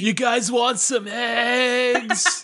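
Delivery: slow, dazed stoner voice. Pretend. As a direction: drawl it like a nineties TV stoner. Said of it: "nineties television high".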